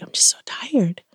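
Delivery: imitating an exhausted whisper; tired whisper. Wife imitating her exhausted husband in the rocker, whispered. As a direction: breathe it out like a spent man. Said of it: "he's like".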